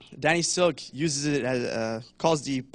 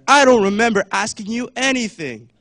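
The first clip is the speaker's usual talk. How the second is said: loud, higher menacing movie line; Samuel Jackson impression; 7 semitones higher, 10 dB louder than usual. Quoting Samuel Jackson in Pulp Fiction to the crowd, loud and menacing. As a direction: bark it like the Pulp Fiction scene. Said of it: "Samuel Jackson".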